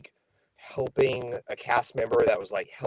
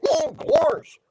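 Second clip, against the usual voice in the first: high, silly cartoon dog voice; a goofy cartoon voice, way up high; 6 dB louder than usual. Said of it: "I did the, my goofy voice".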